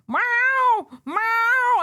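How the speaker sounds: loud, high, croaky cat yowl; croaky loud meows. Retold or imitated. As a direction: yowl like an upset cat in a crate. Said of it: "all of a sudden she went".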